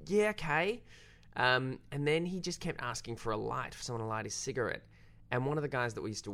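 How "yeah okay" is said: high, louder, uneasy stammer; nervous agreement. Retold or imitated. Crew humours a man threatening murder, high nervous stammer. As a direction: humour him nervously, voice jumping up. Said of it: "We were like".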